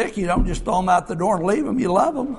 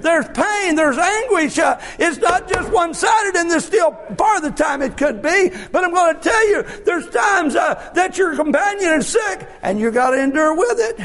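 full-force preaching; 8 semitones higher than usual. Acted. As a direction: drive it home to the congregation. Preacher builds again.

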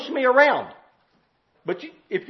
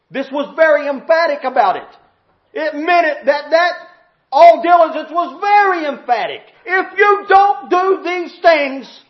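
raised, loud pulpit delivery; emphatic preaching; 7 semitones higher, 8 dB louder than usual. Real, not acted. Preacher stressing how emphatic the scripture is, forceful preaching. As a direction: build to a loud, high, drawn-out warning.

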